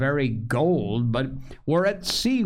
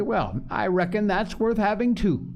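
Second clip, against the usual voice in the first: high-pitched, relieved pirate; joyful; 8 semitones higher than usual. A narrator's reading. Dick takes comfort in his Bible, joyful. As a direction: perk up with joy, pitched well up. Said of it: "cried Dick with a sort of joy".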